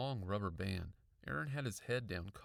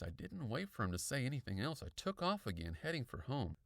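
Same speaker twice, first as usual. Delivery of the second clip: plain reading voice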